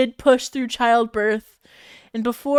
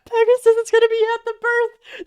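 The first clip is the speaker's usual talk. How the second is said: much higher, louder squeal of disbelief; high mocking voice of disbelief; mock high-pitched repeat; 12 semitones higher, 5 dB louder than usual. Shown as a demonstration.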